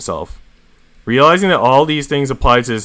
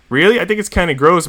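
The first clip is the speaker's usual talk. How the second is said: ordinary delivery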